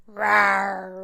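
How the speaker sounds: angry bear roar. A narrator's reading. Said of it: "Old Bear roared".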